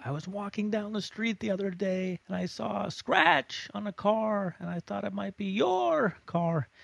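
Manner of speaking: high, loud yelling all through; shouting throughout. Shown as a demonstration. Teacher demoing marking while already yelling, loud. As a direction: yell the whole story. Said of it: "shout those words a little more loudly".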